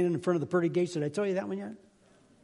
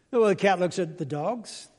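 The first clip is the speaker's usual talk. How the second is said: ordinary talk, nothing marked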